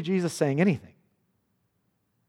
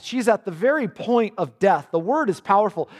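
ordinary delivery

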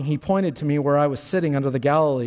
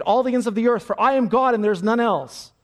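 preaching at full force; 5 semitones higher than usual. Acted. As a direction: thunder the text out to the crowd. Preacher reading text at full force.

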